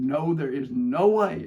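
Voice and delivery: loud, slow flat refusal; a mother putting her foot down, drawn out; mock refusal, loud and slow; emphatic refusal.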